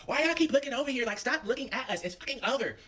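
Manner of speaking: put-on high, sharp girl voice; mock yelling girl. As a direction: imitate her yelling at them. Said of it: "Lil Shawty's yelling".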